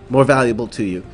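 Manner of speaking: ordinary talk, nothing marked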